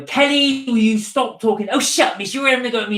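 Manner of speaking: loud, high West Country schoolgirl backchat; cheeky West Country teen shouting back; Vicky Pollard impression. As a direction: mouth off at the teacher in broad West Country. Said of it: "like Vicky Pollard".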